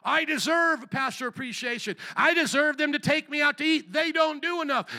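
high, entitled mock complaint; mock self-adornment. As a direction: act out someone puffed up and demanding. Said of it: "on the other side is that self-adornment".

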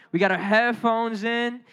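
usual speaking voice

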